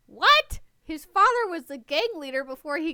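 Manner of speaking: high loud shocked cry. Real, not acted. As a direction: gasp it out in disbelief. Shocked by plot twist.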